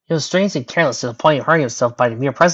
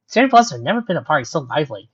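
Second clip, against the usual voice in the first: plain reading voice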